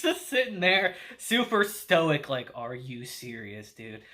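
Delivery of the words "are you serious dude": deadpan disbelief. Retold or imitated. Stoic Takafumi reacting deadpan.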